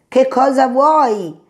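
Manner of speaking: a scared rabbit shouting at his pursuer; trembling cry. Acted. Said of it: "gridò Coniglio tremante".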